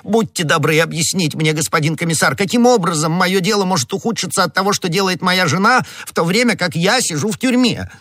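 high-pitched, aggrieved pleading; utter despair. A narrator's reading. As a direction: plead desperately, keeping the pitch up. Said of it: "воскликнул галантерейщик в полном отчаянии".

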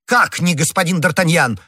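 high, taken-aback outburst; indignant cry. A narrator's reading. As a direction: throw the question back, astonished. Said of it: "в свою очередь закричал комиссар".

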